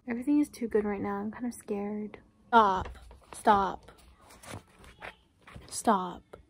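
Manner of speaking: soft, fast reading from the book, almost whispered; quiet reading aloud. A narrator's reading. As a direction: read the passage under your breath, quickly.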